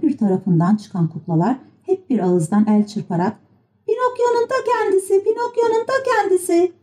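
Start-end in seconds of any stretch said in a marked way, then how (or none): 3.9-6.7 very high-pitched repeated cheer; puppets shouting together. A narrator's reading. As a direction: chant it like excited little puppets. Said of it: "diye bağırıştılar". (tiz kukla bağırışı)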